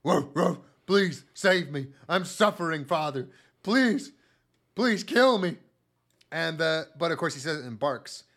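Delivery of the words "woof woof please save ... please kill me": loud, desperate begging; pleading dog voice. Retold or imitated. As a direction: beg for death, strained and loud. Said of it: "the dog's head shoots out of the center of its chest and goes".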